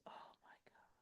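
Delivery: awed whispered gasp of emotion; breathless whisper. Retold or imitated. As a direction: whisper it, overwhelmed. Mother moved by news of son.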